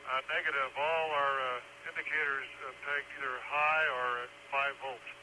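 crackly distant radio transmission; astronaut radio. Real, not acted. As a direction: spacecraft air-to-ground radio. Crew answering over radio.

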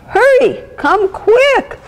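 very high, urgent plea for help; crying out. A narrator's reading. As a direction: squeal for help like a scared child. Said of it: "she cried".

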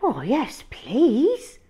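a hint of a keen small boy; lightly voiced boy. Hal agreeing to play, lightly voiced and eager. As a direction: say yes eagerly. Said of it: "said Hal".

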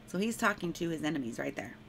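normal talking voice

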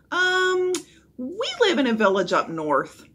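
stall, then make it up; hesitant stalling. A narrator's reading. Jack improvises for the captain, hesitant.

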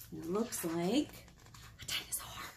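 hushed reveal; whisper. Acted. Librarian whispering the answer to kids.

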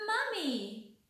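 duckling spotting her mother, happy shout; happy cry. Acted. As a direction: call out in delight at finding mother. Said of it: "quacked the little duckling happily".

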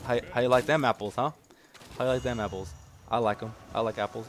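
usual speaking voice

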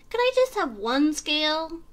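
high small fish voice; pleading little fish, pitched high; little blue fish. A narrator's reading. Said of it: "he said".

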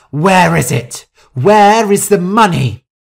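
loud, high, angry questioning; furious demand. Acted. Rosa turning on Shu over the money, furious shout. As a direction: demand it in rising rage.

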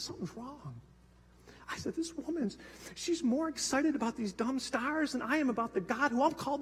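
very soft, breaking confession; hushed tearful admission to his wife. Retold or imitated. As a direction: whisper it through tears.

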